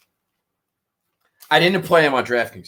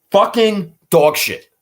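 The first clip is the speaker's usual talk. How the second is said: slow, louder, bitter outburst; disgusted swearing; 5 semitones higher, 5 dB louder, slower than usual. Real, not acted. Bettor reading his bad finishes, slow disgusted curse. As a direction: spit it out slowly in disgust.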